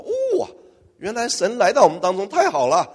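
soaring high oh, then happy cheer; delighted crowd. Acted. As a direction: gush with delight. Crowd delighted gods came.

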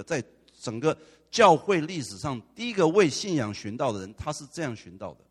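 loud, driving sermon climax; fervent preaching. Real, not acted. Preacher drives home the martyr's witness, loud and fervent. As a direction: preach it out to the whole church.

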